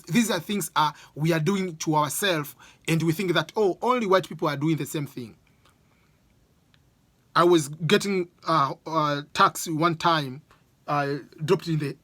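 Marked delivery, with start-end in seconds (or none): none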